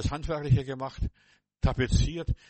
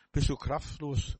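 normal talking voice

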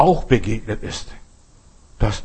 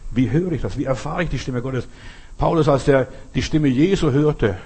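ordinary talk

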